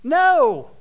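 loud high holler. Acted. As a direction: shout it through the door. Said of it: "He just hollers at the door".

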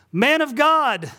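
high, loud, drawn-out holler; calling out. Retold or imitated. Neighbor hailing the pastor across the street, calling out. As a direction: hail someone across the road, stretching it out.

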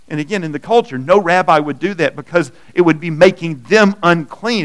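loud, rising sermon voice; preaching at full force. Acted.

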